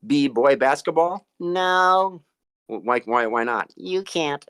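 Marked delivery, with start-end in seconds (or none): 1.4-2.2 high puppet voice. Acted.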